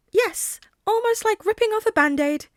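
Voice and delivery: high, bright animal voice; a small creature's polite squeak; high squeaky voice. Acted. Nezu replies.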